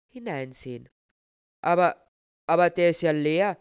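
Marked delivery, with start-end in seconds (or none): 1.6-3.6 loud, stammering astonishment; startled, booming disbelief; involuntary outburst. A narrator's reading. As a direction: blurt it out, taken aback. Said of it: "entfuhr es ihm unwillkürlich".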